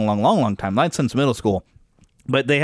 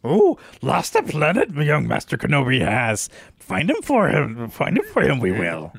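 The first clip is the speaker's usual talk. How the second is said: Yoda voice. Retold or imitated. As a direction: play the wise little master. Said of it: "Yoda had his own bit of sense of humor".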